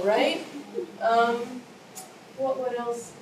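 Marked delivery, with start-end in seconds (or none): none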